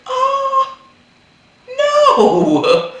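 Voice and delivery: high, loud shocked gasp into a laugh. Real, not acted. Hearing the marriage-law threat, a high loud shocked cry. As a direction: gasp in disbelief then laugh. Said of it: "I was like".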